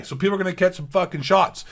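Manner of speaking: usual speaking voice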